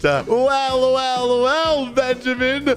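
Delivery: high, drawn-out mock reproach; gloating reproach. Catching Benjamin after the recording is checked, gloating. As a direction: savor each well as you catch him out.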